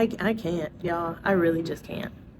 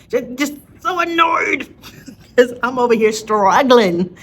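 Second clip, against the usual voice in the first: loud, higher, drawn-out venting with a laugh; annoyed; 5 semitones higher, 6 dB louder than usual. Real, not acted. Venting about co-parent's easy weight loss, loud annoyance. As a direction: wail it out in comic exasperation. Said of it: "Oh, I'm so annoyed".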